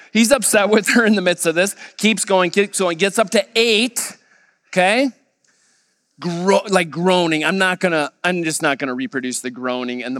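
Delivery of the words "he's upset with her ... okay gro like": ordinary talk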